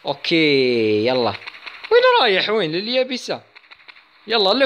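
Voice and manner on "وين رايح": surprised. Real, not acted. Boat target escaping.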